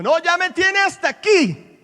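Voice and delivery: high, loud, slow exasperated shout; a mock parent yelling at a child who keeps disobeying; fed-up parent yell. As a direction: act out the parent at the end of their rope. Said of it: "¿Usted alguna vez le ha dicho".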